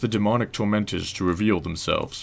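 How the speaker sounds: stoic historian slipping into British; semi-British game character voice, not full British; drifting British accent. Acted. Historian explaining tortured spirits, stoic with drifting British accent. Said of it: "my voices drift in and out of Britishness".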